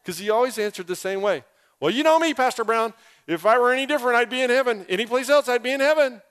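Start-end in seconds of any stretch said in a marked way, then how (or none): 1.8-6.2 high, loud, brash reply imitating Stephen; loud mimicry. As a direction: belt it out to the whole Quick Trip. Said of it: "He's just loud".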